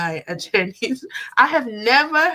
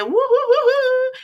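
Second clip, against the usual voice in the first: high, loud cheering woos; 8 dB louder than usual. Real, not acted. Vlogger cheers a new channel member joining, excited. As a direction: celebrate like a crowd.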